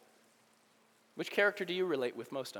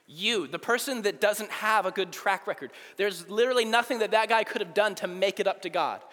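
normal talking voice

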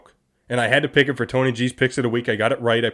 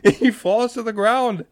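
high, slow words through laughter; can't stop laughing; 9 semitones higher, slower than usual. Real, not acted. Host retelling the runner's fall to co-host, helpless laughter. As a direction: squeeze it out while laughing. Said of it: "I can't say it without laughing".

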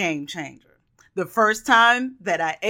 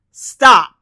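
loud stop; 10 dB louder than usual. Acted. Sudden command in pizza warning.

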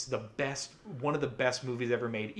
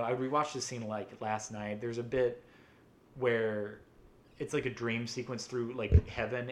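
ordinary talk, nothing marked

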